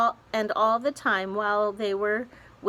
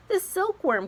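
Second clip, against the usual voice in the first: cried out; 12 semitones higher than usual. A narrator's reading. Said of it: "cried the old green grasshopper".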